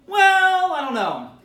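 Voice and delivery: put-on high, loud hesitant "Well" from the kid; unsure teenager. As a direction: play the caught-out teen stalling. Teen answers the rabbi.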